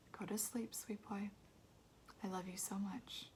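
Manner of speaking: whispered goodnight, soft and tender. A narrator's reading. Mother whispers goodnight to her son, soft and tender. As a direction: hush him to sleep with a whisper.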